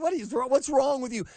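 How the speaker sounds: a touch of exasperated disbelief; mock exasperated. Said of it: "Of course they go".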